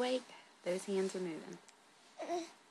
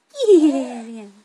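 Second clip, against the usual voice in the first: loud, drawn-out pounce; playful tickle attack; 3 semitones higher, 18 dB louder than usual. Real, not acted. Mother playfully tickling her baby.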